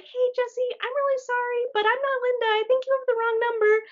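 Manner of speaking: high, sweet apologetic voice; polite apology voice. Retold or imitated. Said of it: "And I was like".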